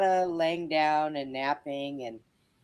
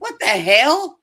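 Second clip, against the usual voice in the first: loud, slow, high disbelief; exasperated; 8 semitones higher, 8 dB louder, slower than usual. Retold or imitated. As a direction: drag it out, incredulous. Inner reaction to coworkers' complaint.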